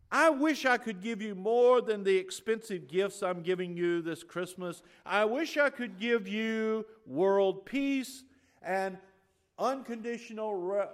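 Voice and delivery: high, loud, slow showman's promise to the crowd; grandstanding mayor. Retold or imitated. Mayor showing off to the crowd, hammed-up high loud speech. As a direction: play the smug mayor buttering up a crowd. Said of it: "And then he says".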